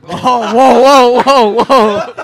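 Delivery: loud startled whoa, half laughing; surprised. Real, not acted. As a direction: burst out in loud disbelief. Shocked by the falsetto attempt.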